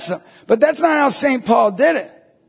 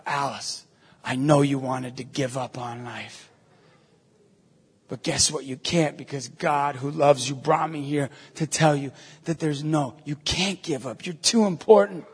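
low, soft reassurance; gentle, sincere; 9 semitones lower, 9 dB quieter than usual. Retold or imitated. Priest to a stranger who wanted to give up, gently reassuring. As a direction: speak softly to someone close to giving up. Retells consoling a despairing stranger.

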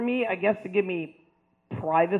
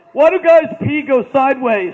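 put-on kid's question; 5 semitones higher, 13 dB louder than usual. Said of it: "question in your question box".

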